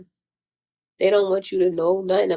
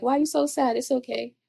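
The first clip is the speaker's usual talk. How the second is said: comforting someone sad; 5 semitones higher than usual. Retold or imitated. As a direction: soothe a sad stranger gently. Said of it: "I'll be talking to them like".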